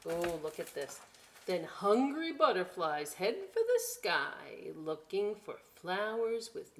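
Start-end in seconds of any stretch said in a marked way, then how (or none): none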